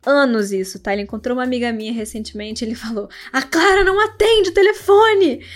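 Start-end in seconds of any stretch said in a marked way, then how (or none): 3.3-5.4 loud, high imitation of a frustrated complaint; exasperated mock yell. Guy complaining to her friend about Clara, exasperated mock yell. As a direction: imitate someone hollering a long-held grievance.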